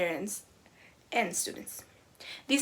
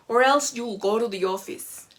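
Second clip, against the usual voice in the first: stern threat; 6 dB louder than usual. Retold or imitated. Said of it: "the teacher says".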